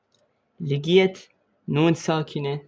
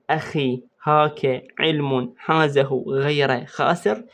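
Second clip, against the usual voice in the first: "أخي هاك علم حازه غير خاسر" ممطوطة ببطء للحفظ; رددها على مهل كأنك تحفّظ طالبًا; إيقاع حفظ بطيء; much slower than usual. Shown as a demonstration. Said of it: "تريد تحفظهن على وزن". (ترديد بطيء لجملة الحفظ)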